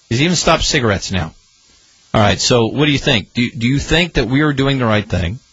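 ordinary talk

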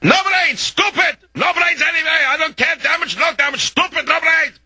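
very high-pitched ranting of an enraged Russian; mock Russian screaming. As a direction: imitate a livid Russian shrieking in broken English, without extra volume. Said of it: "the Russian gets down on his knees".

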